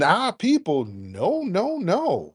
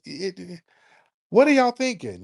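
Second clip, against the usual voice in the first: ordinary talk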